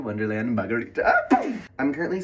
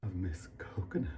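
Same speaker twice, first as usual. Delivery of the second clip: whispered; 5 semitones lower, 9 dB quieter than usual. Acted. Naming coconut milk playfully.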